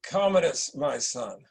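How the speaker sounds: slow, grave dying emperor; a lightly voiced dying ruler. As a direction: give your son a last command, slowly. Dying emperor gives final charge.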